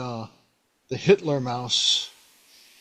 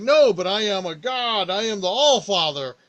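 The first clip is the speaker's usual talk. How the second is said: god insisting; 6 semitones higher, 4 dB louder than usual. Retold or imitated. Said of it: "he's like".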